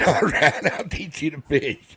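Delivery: high-pitched, laughing retold promise; laughing agreement. Patient agrees to teach his doctor fishing, laughing.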